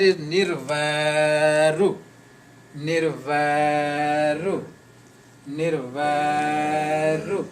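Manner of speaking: nirvairu very slow, long vai, short u at the end; final U sounded. Shown as a demonstration. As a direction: stretch nirvairu out and close on a short "ru". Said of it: "if you put U sound in the end".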